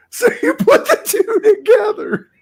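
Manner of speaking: high, loud laughing delivery; laughing while talking. Real, not acted. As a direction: get the words out through real laughter. Laughing at own joke about languages.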